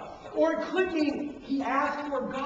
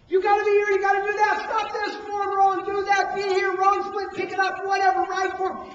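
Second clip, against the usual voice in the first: loud, higher coach commands; barking coach; 6 semitones higher, 6 dB louder than usual. Retold or imitated. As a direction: call out corrections across the track. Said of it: "When I hear them coach".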